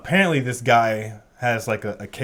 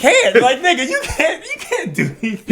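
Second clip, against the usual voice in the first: high-pitched laughing jeer; 11 semitones higher, 3 dB louder than usual. Real, not acted. Viewer addressing the attacker on screen, laughing. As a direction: laugh through the words, pitch raised.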